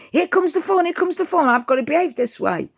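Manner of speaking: a puppy sure the fun is coming; excited dog thoughts. Retold or imitated. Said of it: "it's like".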